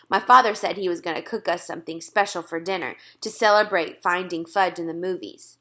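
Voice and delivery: plain reading voice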